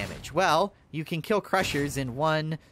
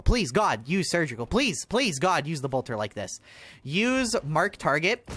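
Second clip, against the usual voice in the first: normal talking voice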